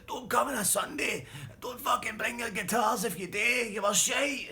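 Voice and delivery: high, loud, slow bellowed insult; insulting shout. Retold or imitated. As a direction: blast them on the way out. Bully insults band.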